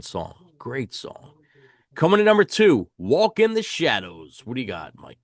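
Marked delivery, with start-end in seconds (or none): 1.9-4.4 countdown announcer. Real, not acted. Host introducing number two in the album countdown, announcer style. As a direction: announce the runner-up with punch.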